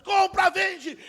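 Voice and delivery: high, loud barked orders; trading-floor shout. Retold or imitated. Trader on the stock exchange floor, loud shout.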